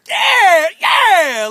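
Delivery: husband reliving his hyped disbelief to his wife. Retold or imitated. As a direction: replay the moment you couldn't contain your excitement. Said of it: "I'm hyped".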